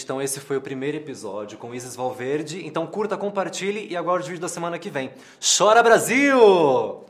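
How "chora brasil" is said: big, slow, drawn-out shout, much higher and louder; catchphrase shout. Shown as a demonstration. Host belting his channel catchphrase to viewers, slow loud shout. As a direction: belt out the channel's signature sign-off. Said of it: "É o bordão que eu uso no canal".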